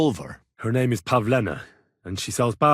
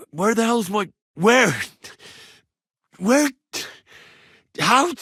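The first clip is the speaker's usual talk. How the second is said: frantic searching; 11 semitones higher, 4 dB louder than usual. Acted.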